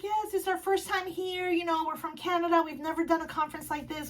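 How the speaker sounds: soft, high, gentle delivery; imitated sweet voice. Amanda telling fan it's their first conference, soft and sweet. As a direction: speak softly and high, sweetly.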